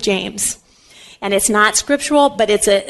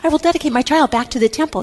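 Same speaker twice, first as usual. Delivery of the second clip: higher-pitched earnest vow, lightly acted; vowing Anna; 7 semitones higher than usual. Anna promises her child to God, lightly acted vow. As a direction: pledge it solemnly in a raised pitch. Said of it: "And Anna said".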